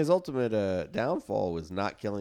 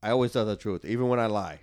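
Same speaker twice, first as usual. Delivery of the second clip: put-on a loose, lightly done Cuban gangster accent; a half-hearted Tony Montana Cuban accent; shitty Scarface accent; 5 dB louder than usual. Quoting Scarface to friends, in a weak Cuban gangster accent. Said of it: "I'm doing a shitty accent".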